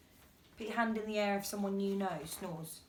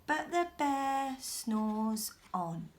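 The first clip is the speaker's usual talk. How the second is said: usual speaking voice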